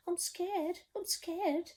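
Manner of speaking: high, whimpering "I'm scared". Acted. Storyteller voicing the cook, high and whimpering. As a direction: whimper it like a timid child. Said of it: "the cook declared".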